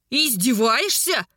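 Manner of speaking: drawn-out, indignant outburst; slow, seething indignant question; flaring with anger. A narrator's reading. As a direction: flare up at being mocked. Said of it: "вспыхнул гневом брюнет".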